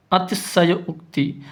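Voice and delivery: ordinary delivery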